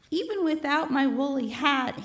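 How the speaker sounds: plain reading voice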